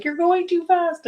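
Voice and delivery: high, whiny complaint; imitating students who say she is too fast; mock student complaint. Art teacher quoting students at live classes, high mock complaint. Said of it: "they're like".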